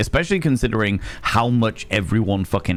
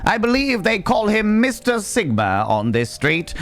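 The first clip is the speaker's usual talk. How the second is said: mock announcer; 7 semitones higher than usual. Guide on the bus roasting heckling kids, hammed-up announcer. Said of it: "But I do like being like".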